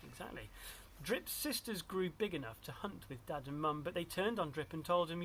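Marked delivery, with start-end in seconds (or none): none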